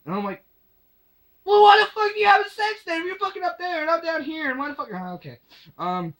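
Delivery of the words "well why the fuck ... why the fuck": re-enacted high-pitched screaming rant; outraged rant. Storyteller re-enacts arguing back at her, outraged rant. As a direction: burst in loud and high, then rant on without easing the pitch.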